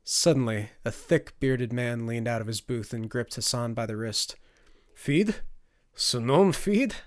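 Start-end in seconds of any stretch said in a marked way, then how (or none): 4.9-7.0 high, loud foreign-tongue challenge; raised, questioning stranger voice; confrontational stranger. Acted. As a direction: demand it with rising disbelief. Stranger grips and challenges Hassan.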